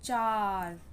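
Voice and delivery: "चार" with a drawn-out aah; teacher's stretched "चार"; long A demo. Shown as a demonstration. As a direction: hold the vowel in "चार". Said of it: "आ का long sound".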